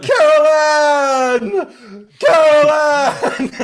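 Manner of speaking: long high wailing shout; screamed name. Retold or imitated. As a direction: wail it like a desperate mother. Imitating the movie mother calling.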